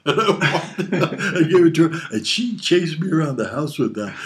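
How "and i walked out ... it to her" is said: laughing through the words, louder. Real, not acted. Father telling his kids the story, laughing as he talks. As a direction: laugh while telling the punchline.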